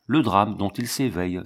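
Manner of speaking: ordinary delivery, nothing marked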